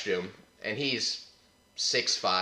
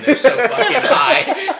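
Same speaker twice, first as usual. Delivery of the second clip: laughing; 12 dB louder, slower than usual. Real, not acted. Laughing at the climbing strippers.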